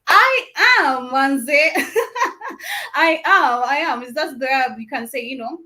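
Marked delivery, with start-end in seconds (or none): 0.1-4.0 laughing. Real, not acted. Host laughs at yacht party comment.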